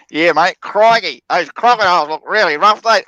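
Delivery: loud, hammed-up Aussie crocodile-man accent; exaggerated Australian accent. Shown as a demonstration. Showing a friend a stronger accent, exaggerated Australian. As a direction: lay the Australian accent on thick. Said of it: "Does that sound more Australian for you".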